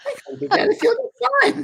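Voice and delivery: very high, hurried mock-Scottish protest; Mary, Scottish lady. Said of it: "I'm trying to my Scottish accent".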